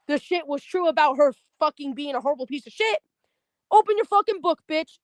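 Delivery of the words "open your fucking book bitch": angry order. Retold or imitated. Telling off a classmate in class.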